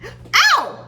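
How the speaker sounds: high, loud pained ow; sharp yelp of pain. Real, not acted. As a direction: yelp as the iron burns you. Just burned her finger.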